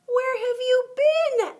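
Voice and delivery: high, loud, slow eager question; excited high cry. A narrator's reading. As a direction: burst out with joy. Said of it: "asked the fish excitedly".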